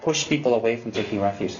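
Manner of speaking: usual speaking voice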